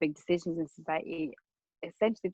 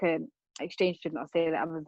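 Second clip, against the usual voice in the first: usual speaking voice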